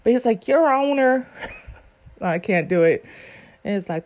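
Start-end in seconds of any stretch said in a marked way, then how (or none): 0.5-1.3 twang. Retold or imitated. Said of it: "I wish I could do the twang".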